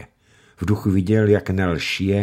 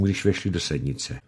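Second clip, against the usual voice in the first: usual speaking voice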